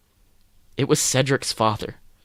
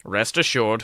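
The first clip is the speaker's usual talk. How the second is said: ordinary delivery, nothing marked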